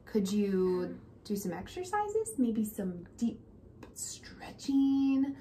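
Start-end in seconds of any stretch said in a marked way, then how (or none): none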